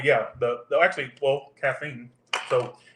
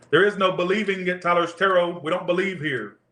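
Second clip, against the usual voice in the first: ordinary talk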